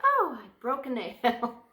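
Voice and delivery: put-on high, slow, prissy girly voice; mock a fussy girly girl over a nail; hammed-up princess complaining about a broken nail; girly whine. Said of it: "I'm really not that girly".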